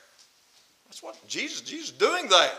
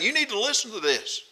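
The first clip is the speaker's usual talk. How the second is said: insistent, raised-pitch urging; forceful preacher's insistence; emphatic; 4 semitones higher, 3 dB louder than usual. Acted. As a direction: press each sentence home to the listeners. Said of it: "he emphatically saying".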